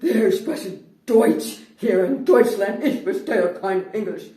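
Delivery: very loud, slow, word-by-word German tirade; apoplectic scream. Retold or imitated. Apoplectic cashier refuses English, screaming in German. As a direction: scream it slowly, hammering each word. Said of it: "she screams out".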